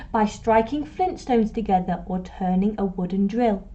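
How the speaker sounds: ordinary delivery, nothing marked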